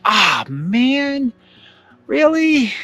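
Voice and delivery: high, drawn-out whine of reluctance; dreading groan. Retold or imitated. Teenager facing the same play twice, dreading groan. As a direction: groan it out high, stretching the words.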